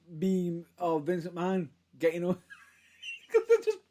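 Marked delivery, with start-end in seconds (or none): 3.3-3.8 high, laughter-choked words; cracking up while trying to explain; real laughing fit. Real, not acted. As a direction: squeal it out through helpless laughter. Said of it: "the reason why I'm laughing".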